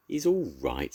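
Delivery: usual speaking voice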